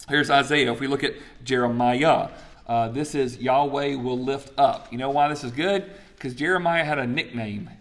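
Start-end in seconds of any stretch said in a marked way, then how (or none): none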